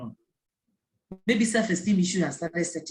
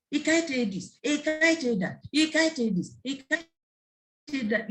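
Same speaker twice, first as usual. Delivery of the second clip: mocking repetition; 6 semitones higher, slower than usual. Mocking parents calling for help.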